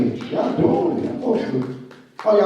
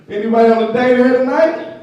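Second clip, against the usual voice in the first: calling out; 7 semitones higher, 7 dB louder than usual. Real, not acted. Host calling out to crowd.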